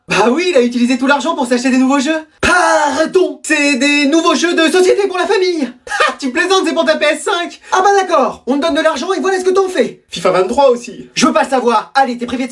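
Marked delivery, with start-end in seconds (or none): 2.4-10.0 indignant protest. Acted. Indignant family argument.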